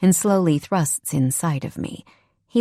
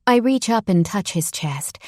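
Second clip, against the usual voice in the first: plain reading voice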